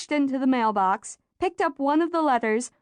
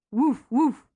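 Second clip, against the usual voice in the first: dog bark. A narrator's reading. Dog barks in story.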